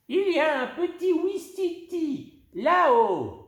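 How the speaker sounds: very high, eager pointing voice; luring them to look up; playful old lady. Acted. Old lady tricks girls. (grand-mère espiègle, voix très aiguë)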